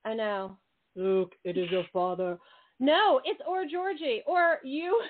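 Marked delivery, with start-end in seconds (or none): 1.0-2.4 mock movie-villain voice, low; low, portentous father reveal; James Earl Jones. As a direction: drop low for a playful film impression. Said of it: "it's not James Earl Jones".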